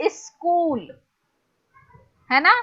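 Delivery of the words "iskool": SCHOOL said as "is-kool", extra i in front; UP pronunciation. Shown as a demonstration. Teacher showing students how UP people pronounce it, SCHOOL as "is-kool". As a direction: put a short i before SCHOOL. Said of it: "People from U P will pronounce it".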